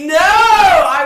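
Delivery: loud, slow shouted protest; shouting. Real, not acted. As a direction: yell it in protest.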